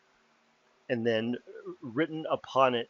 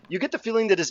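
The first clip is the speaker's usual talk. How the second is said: ordinary talk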